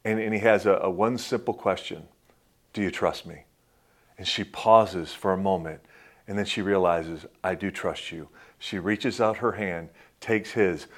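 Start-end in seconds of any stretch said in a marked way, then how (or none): 2.7-3.4 soft, low, tender asking; hushed question. Retold or imitated. Aladdin asks Jasmine tenderly. 7.4-8.3 soft, low, tender reply; hushed answer. Retold or imitated.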